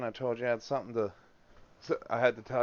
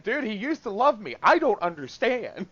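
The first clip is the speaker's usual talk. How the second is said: retold exasperation; 9 semitones higher, 6 dB louder than usual. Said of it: "I'm like".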